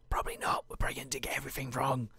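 soft unvoiced whisper; a hushed doubtful aside. Real, not acted. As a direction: whisper it to yourself.

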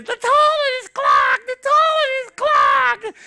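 put-on very high, loud child's shriek; child yelling from the bathroom to mom; screaming kid. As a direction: shriek it like a panicked little kid. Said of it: "This kid comes up and screams".

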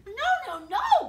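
put-on children refusing the idea; children protesting. A narrator's reading. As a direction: shout it like upset children. Came the children's.

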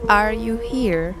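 slow, stilted machine-translator English; careful text-to-speech style questioning; Google Translate voice. Pretend. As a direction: space out each English word like translation software. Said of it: "tengo que hablar así. ... Como Google Translate".

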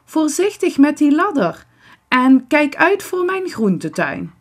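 hoog en luid waarschuwend; naroepend. A narrator's reading. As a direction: roep nog een waarschuwing na als moeder. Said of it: "roept ze nog". (luid naroepen)

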